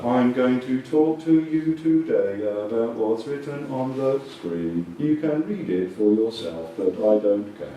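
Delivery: dragging, low, boring monotone; too slow. Shown as a demonstration. Coach showing a boring presenter reading slides, far too slow. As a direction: drone each word out slowly and lifelessly. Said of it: "Too slow".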